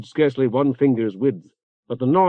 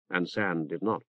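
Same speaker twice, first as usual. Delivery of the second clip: plain reading voice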